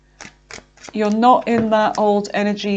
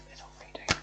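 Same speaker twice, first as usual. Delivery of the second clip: much softer, breathy whisper to herself; 16 dB quieter, much faster than usual. Real, not acted. Reader muttering to herself, repeating the card spot, whisper. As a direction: whisper it under your breath.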